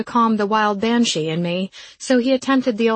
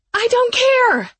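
screaming, very high and loud; shouted; 7 dB louder, slower than usual. A narrator's reading. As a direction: scream it at him. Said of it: "I shouted".

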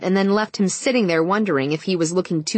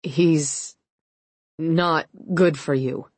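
shaky voice; much slower than usual. A narrator's reading. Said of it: "said in a shaky voice".

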